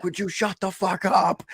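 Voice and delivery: high-pitched mock outburst from fed-up passengers; retold annoyed yell. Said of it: "they'd be like".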